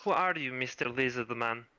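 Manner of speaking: a hint of a confused Russian girl; Russian accent. Confused girl asks the lizardman, light Russian accent. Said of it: "I have to do a Russian accent".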